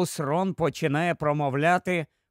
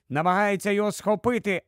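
usual speaking voice

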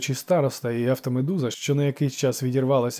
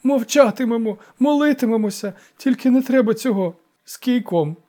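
high, loud begging; frightened elder's lamenting promise; tearful wailing; 10 semitones higher, 6 dB louder than usual. A narrator's reading. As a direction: plead desperately through a wail. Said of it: "заголосив староста".

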